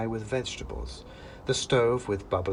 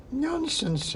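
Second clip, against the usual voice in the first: brisk witch. Acted. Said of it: "said the old woman".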